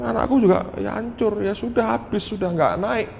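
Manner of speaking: high-pitched plea, protesting own powerlessness; helpless pleading. Retold or imitated. Father begging God for his child, helpless pleading. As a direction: plead upward like a helpless servant.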